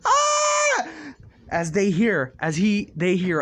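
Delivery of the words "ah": short piercing shriek, very high; high woman-like scream. Acted. Bakugo seeing who is in the room, shrieking. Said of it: "woman screaming".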